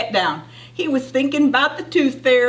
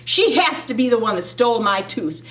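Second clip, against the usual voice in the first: plain reading voice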